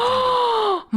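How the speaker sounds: sharp high gasp of shock. Real, not acted. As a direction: gasp in surprise.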